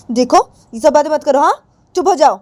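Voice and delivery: loud, high order to stop; angry scolding. Acted. Acted scolding after talk of dying. (गुस्से में ऊँची आवाज़ से डाँटते हुए)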